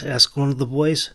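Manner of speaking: ordinary delivery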